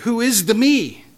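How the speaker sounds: ordinary talk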